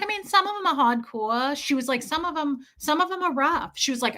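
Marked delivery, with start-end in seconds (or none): 0.0-1.6 imitated New York lady, r's dropped; New York accent. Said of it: "B lady's a New Yorker". 2.0-3.8 higher-voiced imitation of New York lady; New York accent. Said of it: "B lady's a New Yorker".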